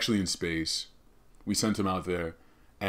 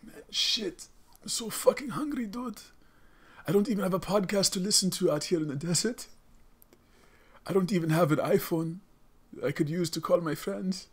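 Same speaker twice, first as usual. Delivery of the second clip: very high put-on voice of complaint; hungry desert ancestor; 11 semitones higher than usual. Ancestor lonely on a vision quest, high complaining voice. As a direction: lament it in a much higher character voice. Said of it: "this ancestor's on a vision quest".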